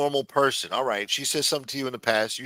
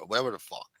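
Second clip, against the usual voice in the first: ordinary delivery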